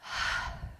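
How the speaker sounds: imitated soft relieved sigh, unvoiced. Mother imitating her daughter falling asleep, soft sigh. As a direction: let out a breathy sigh of relief. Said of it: "She went like this".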